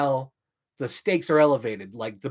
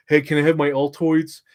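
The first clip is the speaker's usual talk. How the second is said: imitating Michael Douglas; 2 semitones higher, 6 dB louder than usual. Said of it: "Michael Douglas is like".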